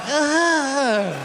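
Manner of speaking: high, slow whiny groan; whiny kid. Retold or imitated. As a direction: sulk like a bored teenager. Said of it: "The kid's like".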